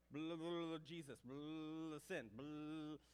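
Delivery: loud droning blah-blah imitation; mock street preacher, slurred and drawn out; megaphone preacher. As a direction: drone gibberish, only key words poking out.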